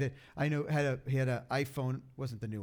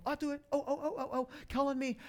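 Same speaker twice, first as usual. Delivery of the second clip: put-on high, laughing eager kid begging to be picked; eager schoolkid; 10 semitones higher than usual. Preacher mimics the kid in school, laughing high eager plea. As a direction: bounce like a kid desperate to be called on. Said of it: "remember that kid in school".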